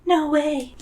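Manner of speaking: put-on high, gleeful girl voice; grinning Zoe. A narrator's reading. Zoe confessing her fib to a friend, grinning. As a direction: say it through a grin, high. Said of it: "she says, grinning from ear to ear".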